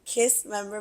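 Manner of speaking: ordinary delivery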